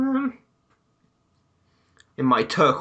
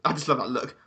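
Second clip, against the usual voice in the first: normal talking voice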